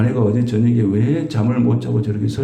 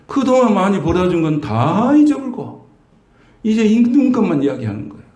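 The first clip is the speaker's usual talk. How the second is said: complaining wife; 8 semitones higher than usual. Retold or imitated. Wife who forgets husband's earnings.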